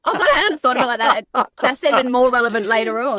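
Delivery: high, loud laughing delivery. Real, not acted. Host caught out by a car mechanic's name, laughing.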